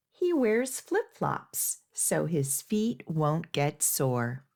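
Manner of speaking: plain reading voice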